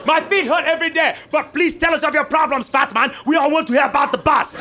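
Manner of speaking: an audience member jeering at the comic; sarcastic heckler. Retold or imitated. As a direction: yell back at the comic.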